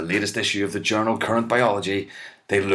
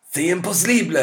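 French exclaim; 11 semitones higher, 4 dB louder than usual. Retold or imitated. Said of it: "asserted his contemporary".

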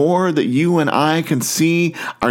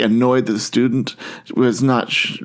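normal talking voice

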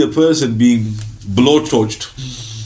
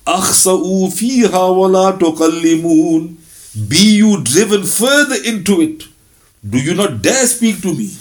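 stern rebuke; 6 semitones higher than usual. Acted. Allah's rebuke to people of hell.

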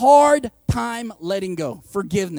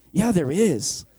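feigned innocence; 3 semitones lower, slower than usual. Retold or imitated. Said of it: "knowing that she's being passive aggressive".

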